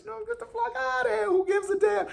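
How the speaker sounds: very high falsetto, soft; squeaky mock dismissal. Real, not acted. Fan mocking the Giants in a high squeaky falsetto. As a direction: toss it off in a silly squeal.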